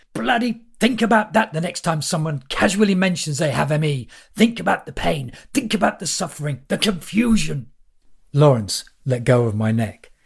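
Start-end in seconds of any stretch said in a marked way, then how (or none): 0.1-7.7 ranting. Acted. Lawrence ranting about M E.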